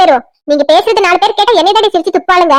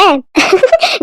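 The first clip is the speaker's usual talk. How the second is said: short laugh. Acted. Laughs while greeting the bride.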